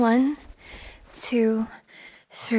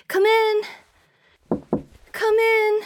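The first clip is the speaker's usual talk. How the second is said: higher, louder call to someone outside; 10 semitones higher, 3 dB louder than usual. Acted. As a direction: shout it across the house to be heard. Calling to landscaper from afar.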